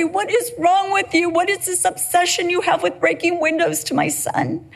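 half-hearted near-crying exasperation; a hint of a weepy, exasperated mom; mock tearful complaint. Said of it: "I could cry and say".

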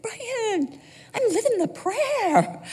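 high, laughing, slow reply. Retold or imitated. Wife telling husband Brian she's living the prayer, laughing. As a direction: laugh through the words.

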